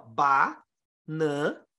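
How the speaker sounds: Ba-nan-na split slowly into three syllables. Shown as a demonstration. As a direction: spell out Ba-nan-na beat by beat, very slow. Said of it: "você tem isso aqui, ó".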